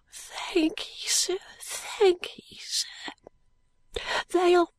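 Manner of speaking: put-on high, breathless, dying boy whisper; fading boy, barely voiced. A narrator's reading.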